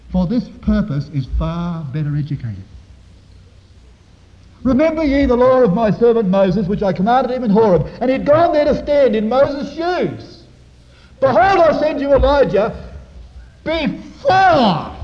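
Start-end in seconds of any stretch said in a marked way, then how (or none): 4.6-14.9 loud, high scripture proclamation; ringing pulpit delivery, building to a cry; preaching at full force. Real, not acted. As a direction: declaim the verse with mounting force.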